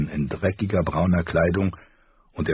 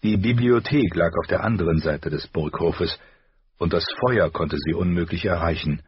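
plain reading voice

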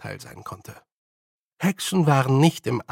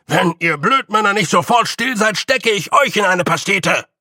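loud, higher bellow to shut them up; angry shout; 7 semitones higher, 7 dB louder than usual. Acted. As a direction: Feegle chief shouting down his crew. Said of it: "rief Rob irgendwer".

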